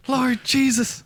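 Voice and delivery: high mock Irish accent, pleading Jesus; a badly done Irish lilt, pitched high; mock Irish prayer. Host mocks a religious Northern Irish lover, Irish accent. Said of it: "I'm terrible at accents".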